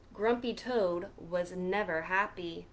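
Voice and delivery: usual speaking voice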